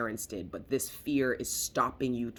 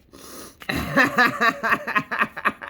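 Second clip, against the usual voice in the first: high, loud cackle; laughing at her own outburst; 10 semitones higher, 10 dB louder than usual. Real, not acted. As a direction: let out a big laugh. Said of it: "I can hear you guys laughing".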